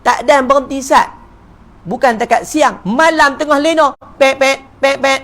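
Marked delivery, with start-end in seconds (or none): 4.2-5.2 imitated car horn. Imitating car horns at night.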